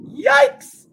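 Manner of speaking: yelped high and loud; a big theatrical yikes; mock shock. Reacting to bad July stats.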